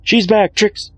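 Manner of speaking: high, loud, frightened warning; urgent, alarmed cry; panicked warning. Acted. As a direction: shout at her friend to run. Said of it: "the panicked mare".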